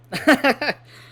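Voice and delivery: short, loud, high laugh. Real, not acted. Laughs after gushing about book.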